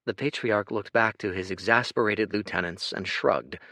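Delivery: ordinary delivery, nothing marked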